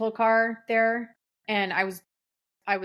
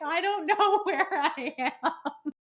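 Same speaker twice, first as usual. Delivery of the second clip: panicked; 8 semitones higher, slower than usual. Retold or imitated. Lost, panicking on phone.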